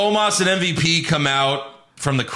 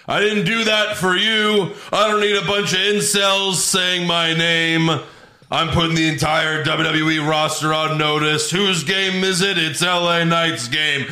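higher, emphatic wrestler promo imitation; L A Knight; 4 semitones higher than usual. Host as a wrestler telling off the crowd, emphatic promo. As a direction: declaim it to the crowd, punching the catchphrase. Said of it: "L A Knight tells the crowd".

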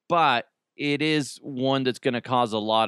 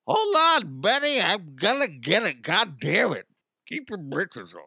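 cartoonish old-husband voice, starting high; hammed-up old codger, pitch falling away; flustered old man; 5 semitones higher than usual. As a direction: grumble back as a harried old man. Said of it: "a bunch of old guys rushing".

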